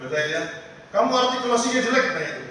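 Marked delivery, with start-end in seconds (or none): 0.9-2.1 mock-angry judge, higher and louder; juri marah. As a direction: scold like a cross talent-show judge. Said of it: "juri-jurinya marah-marah itu". (juri galak yang memarahi peserta)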